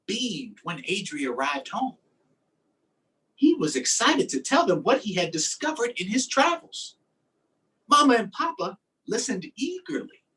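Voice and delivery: lively, sing-song picture-book reading; reading to children. Acted. Teacher reading a fish book to her class, lively storytime voice. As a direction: read it out like storytime for little kids.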